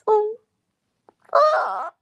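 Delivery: very high, loud gushing over the bird; delighted squeal. Real, not acted. Watching a little robin preen, a high delighted coo. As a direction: melt at something adorable.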